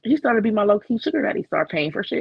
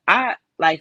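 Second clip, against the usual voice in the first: ordinary talk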